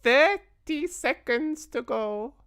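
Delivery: high, slow witch voice; a gloating witch counting down; witch's cry. A narrator's reading. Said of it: "cried the Grand High Witch".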